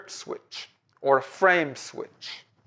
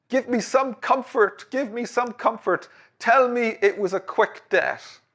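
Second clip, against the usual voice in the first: pleading through tears; 7 semitones higher than usual. Acted. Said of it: "She's crying".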